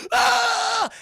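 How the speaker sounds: high, loud mock scream; long piercing shriek of fright; frightened scream. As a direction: scream like a cartoon dog seeing a monster. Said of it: "chat, the shadow creature".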